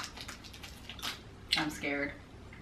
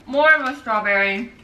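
ordinary delivery

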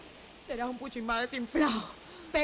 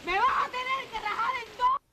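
desperate cry; 9 semitones higher than usual. Acted. Wife's desperate threat.

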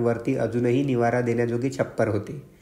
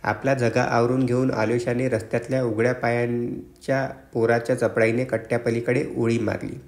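ordinary delivery, nothing marked

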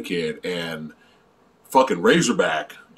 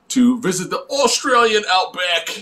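hammed-up Australian accent, pitched high; exaggerated high-pitched Australian twang; 7 semitones higher than usual. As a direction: put on a playful high Aussie accent. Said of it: "I won't stop doing that".

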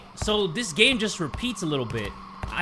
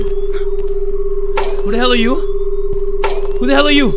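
loud, raised, frightened challenge; scared; 6 semitones higher, 9 dB louder, slower than usual. Real, not acted. Player meeting an unknown figure in the game, scared. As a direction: call out in fright at a stranger. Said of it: "I'm so scared".

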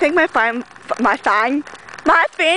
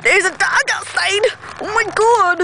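ordinary talk

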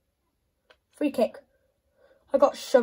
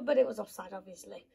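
ordinary delivery

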